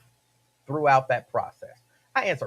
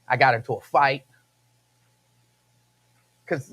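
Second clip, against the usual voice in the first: usual speaking voice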